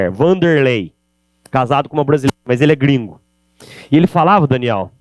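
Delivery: ordinary talk, nothing marked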